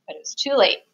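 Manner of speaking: plain reading voice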